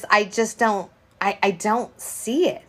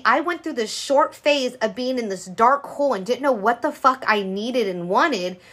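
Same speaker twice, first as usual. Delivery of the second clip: ordinary delivery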